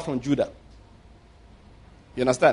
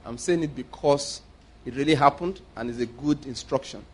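usual speaking voice

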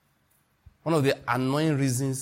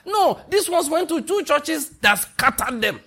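high, loud and slow, hammering each word; preaching outburst; 11 semitones higher, 4 dB louder, slower than usual. Real, not acted. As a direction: drive it home from the pulpit. Preacher rising to full force.

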